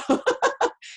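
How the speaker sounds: a high, breathy burst of laughter. Real, not acted. Laughing at Randi's challenge.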